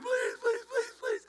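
high, whiny mock begging; Ric Flair begging. Preacher mimicking a wrestler begging for mercy, high whine. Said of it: "blowing in the wind".

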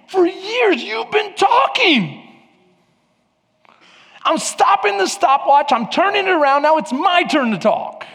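very high, exasperated preaching; God confronting Job. Acted. God tells Job his turn to listen, high exasperated confrontation. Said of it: "Think about what God is saying".

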